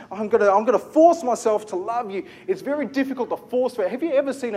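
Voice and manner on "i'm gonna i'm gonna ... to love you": forced resolve. Retold or imitated. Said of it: "you know".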